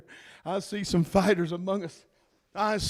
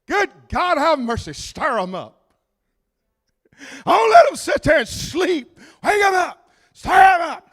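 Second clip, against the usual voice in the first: high and loud shouted exhortation; preacher shouting at the congregation to wake up; full-force preaching; 6 semitones higher, 5 dB louder than usual. Acted. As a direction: wake the sleeping pews up.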